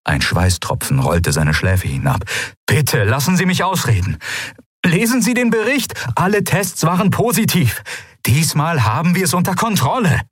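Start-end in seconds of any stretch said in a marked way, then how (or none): 2.7-10.2 desperate plea. Acted. Aide pleading desperately.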